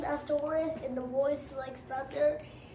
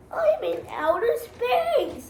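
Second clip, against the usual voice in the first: high, slow, thrilled cry; excited shout; 8 semitones higher, 6 dB louder, slower than usual. Acted. Boy flying his spaceship, thrilled and loud. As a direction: shout it out like a kid astronaut. Kid voicing spaceship adventure.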